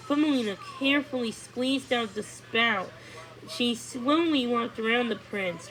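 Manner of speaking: plain reading voice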